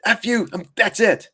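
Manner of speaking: high mock rage-quit; hammed-up frustrated trader, pitched high; fed-up trader. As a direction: squeal it like you're quitting for good. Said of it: "And then I'm bu".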